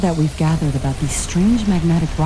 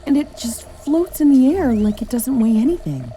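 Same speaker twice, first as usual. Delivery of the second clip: awed; 7 semitones higher than usual. Acted. Awe at floating alien object.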